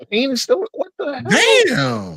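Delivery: high, very slow disbelief; real shock at the game. Real, not acted. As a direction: stretch out the outrage. Shocked at the play.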